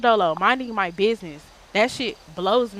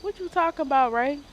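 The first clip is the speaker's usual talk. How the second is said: sudden high yelp of disbelief; surprised what; 7 semitones higher than usual. Real, not acted. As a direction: caught off guard, shoot the pitch up. Reacting to chat message.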